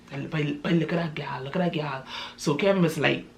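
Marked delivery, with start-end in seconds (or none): 0.1-2.0 hushed, softer whisper. Retold or imitated. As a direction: whisper it so she won't hear. Spotting a girl across the bar.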